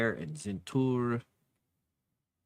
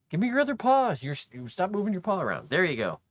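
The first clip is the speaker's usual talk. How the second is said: high insistent pet voice; pet talk; 9 semitones higher, 4 dB louder, faster than usual. Real, not acted. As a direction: coax the paws in a high voice. Handling the cat's paws.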